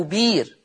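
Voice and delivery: long smooth ee in بير; hamza turned to ya. Shown as a demonstration. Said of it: "تبدل ياء، فتصير بيس".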